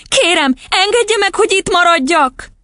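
put-on very high, imploring little-girl voice; squeaky earnest plea; pleading child. A narrator's reading. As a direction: beg desperately as a child. Girl begs to stay.

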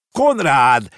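loud call with a falling end; shouting. A narrator's reading. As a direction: shout the boy's name after him. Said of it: "kiabálta a bácsi". (hangos kiáltás)

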